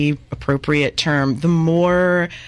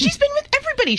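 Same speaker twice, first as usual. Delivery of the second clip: very high, insistent gossip; shrieking gossip; much faster than usual. Acted. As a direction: insist on the scandal, higher. Repeats gossip louder higher.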